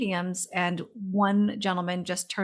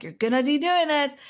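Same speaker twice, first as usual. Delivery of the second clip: higher, louder grandmother voice, firm; insistent grandma; 7 semitones higher, 4 dB louder than usual. Retold or imitated. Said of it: "she's like".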